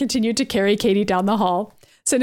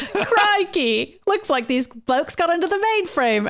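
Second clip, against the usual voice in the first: higher Australian Pyro voice; Australian accent; 7 semitones higher, slower than usual. Acted. Pyro spotting the intruders, Australian accent. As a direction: lean into the Aussie twang. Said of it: "Australian accent".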